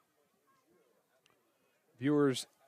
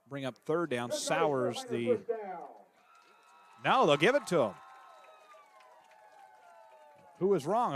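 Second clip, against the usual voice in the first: steady radio play-by-play call, tracking each snap; live play-by-play. Acted. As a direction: call the game for listeners at home.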